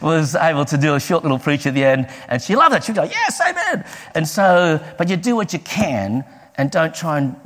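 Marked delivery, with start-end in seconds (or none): 3.1-3.8 high, eager imitated cheer; delighted amen. Old lady cheering the preacher, retold.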